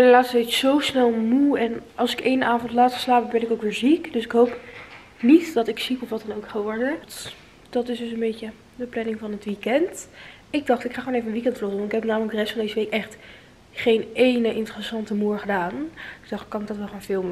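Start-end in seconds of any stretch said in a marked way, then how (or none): none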